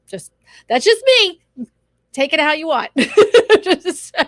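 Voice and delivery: high laughing burst. Real, not acted. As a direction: laugh it off loudly. Laughing off her opinion.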